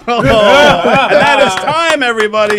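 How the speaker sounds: loud, drawn-out announcer call; big host shout to the room; calling time. Real, not acted. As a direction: call the end of the round over laughter. Host calls time on the battle.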